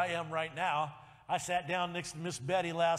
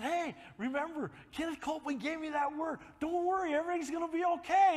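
higher, louder, rushing to a joyful shout; excited reassurance; 9 semitones higher, 4 dB louder than usual. Retold or imitated. Father telling his daughter on the phone not to worry, building to a shout. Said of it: "I said".

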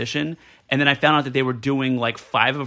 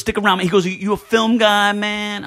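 seventies Puerto Rican; 7 semitones higher, 3 dB louder than usual. Retold or imitated. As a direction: lightly ham up the seventies Puerto Rican street swagger. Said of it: "he thinks he's Puerto Rican".

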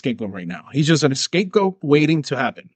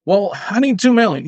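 greedy demand; 7 semitones higher, 3 dB louder than usual. Retold or imitated. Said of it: "I was like".

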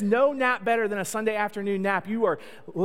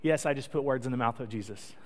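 normal talking voice

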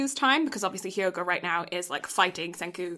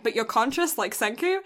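usual speaking voice

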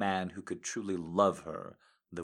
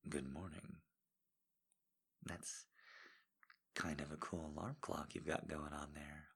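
whisper; 4 semitones lower, 17 dB quieter than usual. Acted. Whispered morning pillow talk.

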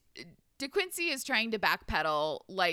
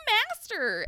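high pleading servant; 11 semitones higher, 4 dB louder than usual. Retold or imitated. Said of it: "is all".

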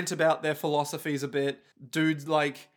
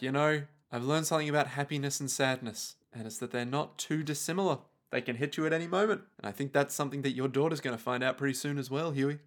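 soft, understated parting warning; quiet musing; 3 semitones lower, 7 dB quieter than usual. Acted. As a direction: reflect softly, keeping it offhand. Voices Elmer's quiet parting warning.

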